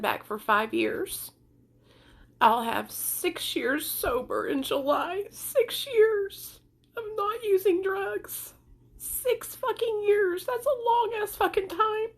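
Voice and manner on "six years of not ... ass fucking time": high, slow tearful insistence; crying. Real, not acted. Mother defending her years sober to critics, crying. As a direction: insist on it through real tears. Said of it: "The reason why I'm crying".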